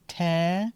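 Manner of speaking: te with a short E; te, short E alone. Shown as a demonstration. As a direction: isolate the te syllable. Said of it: "Short E".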